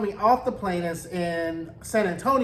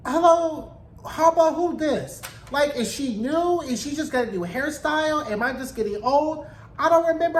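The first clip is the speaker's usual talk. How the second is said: surprised hello; 6 semitones higher than usual. Real, not acted. Surprised at unfamiliar cast member.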